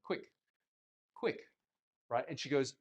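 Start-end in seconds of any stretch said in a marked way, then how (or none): 0.0-1.4 "Quick" said short and crisp, twice; short, quick pronunciation. Retold or imitated. Pupil shows coach how the word sounds, short and quick. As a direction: pop out each "quick" briefly. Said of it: "now pronounce the word quick".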